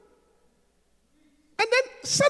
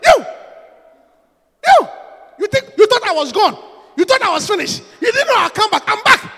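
loud gloating comeback, pitched up; mock triumphant taunt; 7 semitones higher, 9 dB louder than usual. Preacher voicing a triumphant Jesus, gloating loud. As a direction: crow over them like a returning hero.

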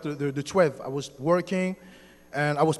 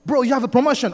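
high, loud excited exclamation; retold excited scream; 6 semitones higher, 7 dB louder than usual. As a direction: cry out the big news as an excited coworker. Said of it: "And he screamed".

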